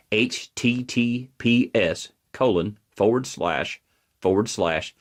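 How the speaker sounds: slow, letter by letter dictation; said slowly. Shown as a demonstration. Speaker dictating a web address to viewers, slow and deliberate. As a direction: spell it out slowly for note-takers. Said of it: "so I'll say this slowly".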